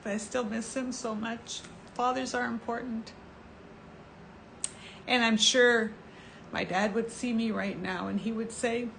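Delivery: fighting tears while talking about her late dad; choked-up and hushed; holding back tears. Real, not acted. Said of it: "I'm gonna cry".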